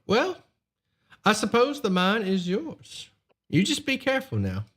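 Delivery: lightly voiced smooth noble; a hint of a polite nobleman; noble's voice.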